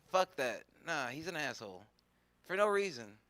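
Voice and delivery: usual speaking voice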